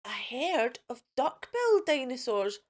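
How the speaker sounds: ordinary delivery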